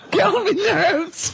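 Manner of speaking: high, drawn-out laughing squeal; real laughter breaking up the words; laughing repeat. Real, not acted. Laughing at the guest's phrase.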